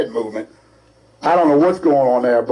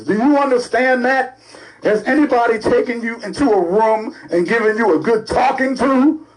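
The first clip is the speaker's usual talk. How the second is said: higher, pressing questions at the mayor; indignant scolding; 7 semitones higher than usual. Real, not acted. Host scolding the absent mayor, indignant and higher.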